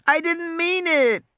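put-on high, slow, wailing girl's voice. A narrator's reading. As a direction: plead high and drawn out. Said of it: "Lex wailed".

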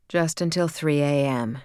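ordinary delivery

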